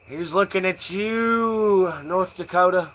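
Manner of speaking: mock a chirpy Midwesterner; Midwestern folk accent. Stoner mocking Midwestern folk, hammed-up Midwestern accent. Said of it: "the Midwestern folk".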